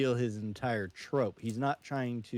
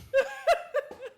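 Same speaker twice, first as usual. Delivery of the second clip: high laugh; 6 dB louder than usual. Real, not acted. Laughing after Elmo impression.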